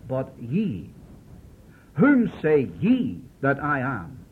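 YE singled out, pause after it; slow, pointed question leaning hard on "ye"; emphatic, personal question. Retold or imitated. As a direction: put deliberate weight on "whom" and "ye". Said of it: "he'll make it very emphatic".

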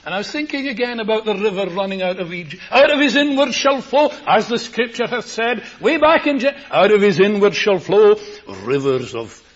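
high, loud fervent preaching; preaching at full force. Acted. Preacher booming about palm trees and Kidron, loud and high. Preacher quoting scripture with fervour.

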